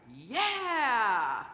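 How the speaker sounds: loud, drawn-out mock cheer; excited crowd cheer. Pastor voices people hearing Jesus preach, excited cheer. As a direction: cheer as an impressed crowd would. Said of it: "and they're like".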